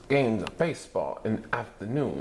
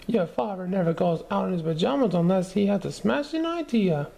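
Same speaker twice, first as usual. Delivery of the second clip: very high, louder cheery mom; reassuring mother; 11 semitones higher, 3 dB louder than usual. A narrator's reading. Mother reassuring the kids, high and bright. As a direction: sing it out brightly. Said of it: "said Missus Lizardo".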